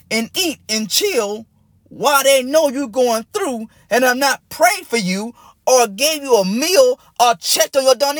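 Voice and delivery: high, slow preaching climax, building to a near-whisper; fervent sermon crescendo, pleading with the congregation; impassioned preaching. Acted. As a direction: preach it hard at the top of the voice. Preaching at full force on neglect.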